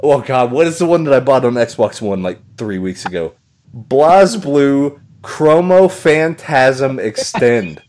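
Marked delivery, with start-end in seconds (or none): 3.8-7.8 slow dramatic reading. Pretend. Reading absurd title slowly.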